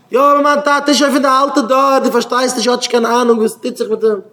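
high, loud, agitated mock objection; acted loud protest. Child objecting about an old-fashioned father, acted loud protest.

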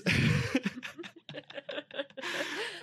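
long rising giggle; high giggle. Real, not acted. Laughing at his own coming-out story, high giggle. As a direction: let a high giggle ride up.

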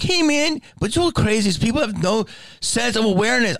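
imitating a dopey fan in a high voice; high guy voice. Fan chatting at him about nothing, high dopey voice.

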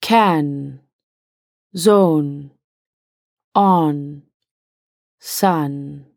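"Can", "zone", "on", "sun" with nasalized vowels, slow and low; nasal vowels. Shown as a demonstration. Teacher demonstrates nasalized French vowels, nose pinched. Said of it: "Try pinching your nose when pronouncing these".

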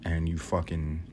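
ordinary delivery

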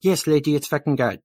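lightly voiced rattled handler; exasperated bystander. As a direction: scold the lady in a fluster.

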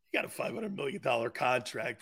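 ordinary talk

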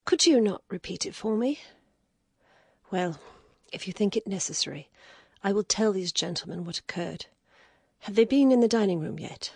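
an exhausted lady, reluctant; weary lady. Acted. Lady speaks in high voice.

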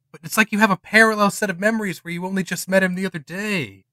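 ordinary talk

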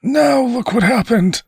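high, strained angry cry; angry shouting. A narrator's reading. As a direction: accuse in a high-pitched shout. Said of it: "he was shouting".

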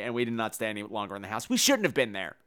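ordinary delivery

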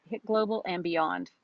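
ordinary talk

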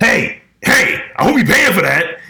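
imitated sharp call across a store. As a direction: re-enact hollering at someone to stop. Shopkeeper calling out to a thief.

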